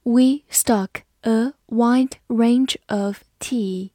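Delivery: slowly, one word at a time; deliberate word-by-word teacher reading. Shown as a demonstration. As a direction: leave a gap after each word for learners. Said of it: "好，慢慢来读".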